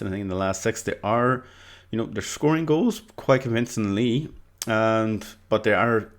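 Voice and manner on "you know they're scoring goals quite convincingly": ordinary talk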